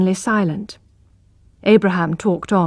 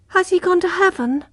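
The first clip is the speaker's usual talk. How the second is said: mild tearful question; 10 semitones higher than usual. Acted. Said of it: "asked Abraham in tears".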